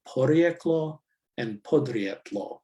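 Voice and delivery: "porijeklo and podrijetlo" said with Croatian vowels and a tapped r; Croatian word pair. Shown as a demonstration. Lecturer reading out the radio word pair, in Croatian pronunciation.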